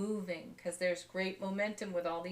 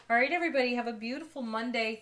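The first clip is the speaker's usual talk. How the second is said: bright sign-off; 4 semitones higher, 6 dB louder, faster than usual. Real, not acted. Cheerful sign-off to viewers.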